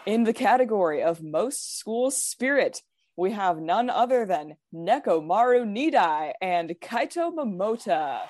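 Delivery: measured, dramatic awards announcer voice. Pretend. Reading out the final superlative results to friends, dramatic announcer voice. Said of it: "dramatic announcer voice?".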